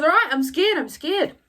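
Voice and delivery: high, loud mock giving-in; retold goaded admission. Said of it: "to get her to say she's scared".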